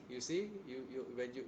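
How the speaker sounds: ordinary talk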